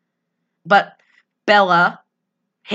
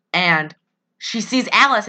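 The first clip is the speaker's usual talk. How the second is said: ordinary talk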